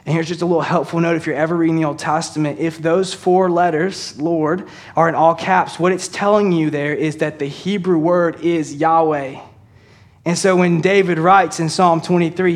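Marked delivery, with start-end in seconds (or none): none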